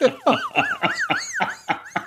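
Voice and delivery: very high, real cackling laugh; helpless laugh. Real, not acted. Host breaking down over the salad story, helpless laughter. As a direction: crack up completely at your own story.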